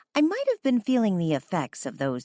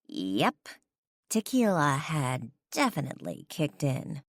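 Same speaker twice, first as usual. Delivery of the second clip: ordinary delivery, nothing marked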